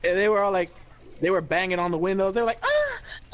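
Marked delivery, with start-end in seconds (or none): 2.6-3.3 high yelps. Retold or imitated. Inmates banging and yelling.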